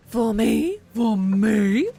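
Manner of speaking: loud, eager dog voiced as a person; excited dog voice. Gretchen the dog begging over bacon, loud and eager. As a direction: squeal it like a thrilled pup.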